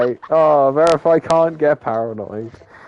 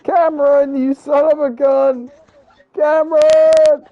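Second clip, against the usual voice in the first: imitating a furious driver, much higher and louder; mock angry yell; 13 semitones higher, 5 dB louder than usual. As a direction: yell it like a wronged racer, the last name drawn out. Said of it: "he's probably yelling at me right now".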